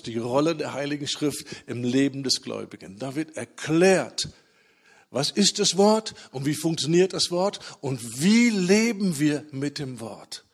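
ordinary talk

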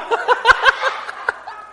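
high breathless silent laugh; high wheezing laugh. Real, not acted. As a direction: crack up without voice. Preacher cracking up at story.

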